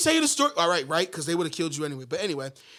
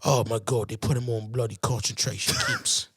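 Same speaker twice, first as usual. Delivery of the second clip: mock British accent; 9 semitones lower, 5 dB quieter than usual. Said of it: "that's how he was talking in the movies".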